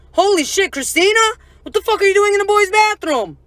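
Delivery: high, loud imitation of a stunned guy; retold incredulous outburst; mock shocked disbelief. Old schoolmate recognizes her, shocked.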